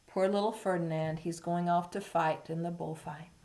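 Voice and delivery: plain reading voice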